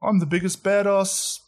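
high mock Kiwi accent; hammed-up, high-pitched New Zealand accent. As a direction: parody a bounty hunter with a New Zealand accent. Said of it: "liked the New Zealand accent".

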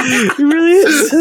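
high, slow words through crying laughter; gasping it out while laughing; laughing till crying. Real, not acted. Laughing fit.

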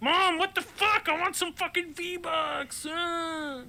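put-on high, slow whining kid; whiny kid. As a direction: whine at Mom like a bratty kid. Kid demanding V-Bucks.

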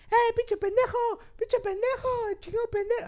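high, yelled stadium insults; kid yelling insults. Retold or imitated. Young friend shouting at players from the stands, high yell. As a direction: scream abuse at the pitch.

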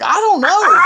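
high, half-shouted reply; baffled outburst. Retold or imitated. Wrestler answering his tag partner's question, baffled. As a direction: throw it out high and loud, clueless.

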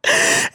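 helpless squealing laugh at mom's joke; loud high laugh burst. Real, not acted. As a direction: crack up hard and loud. Bursts out at mama's idea.

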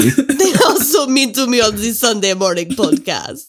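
mock French accent; hammy offended French voice; french chef. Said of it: "Are you, are you still Felix?".

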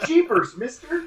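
put-on high, excited, slow gee-whiz kid voice; wholesome 1950s kid. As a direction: squeal it like a wide-eyed schoolboy. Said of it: "the disciples sound like, uh, nineteen fifties ... eight-year-old Jimmy".

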